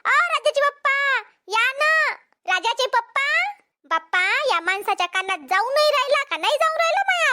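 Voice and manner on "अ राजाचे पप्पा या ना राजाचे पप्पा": calling for Raja's father across the field. Acted. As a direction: call across the field for help. Calling her husband from afar.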